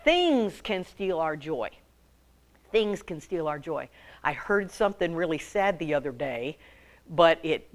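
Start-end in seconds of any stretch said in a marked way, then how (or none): none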